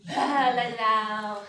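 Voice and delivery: long, loud exhale on the words; drawn-out, forceful sigh of release. Shown as a demonstration. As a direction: let the breath pour out loudly. Leading group in audible sighing release.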